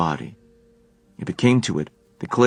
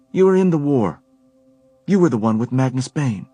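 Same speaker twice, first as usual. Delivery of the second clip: higher, louder accusing woman's voice; Helen, pointed; 7 semitones higher, 5 dB louder than usual. A narrator's reading. Helen confronting Alec about Magnus, pointed. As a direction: press him on his past.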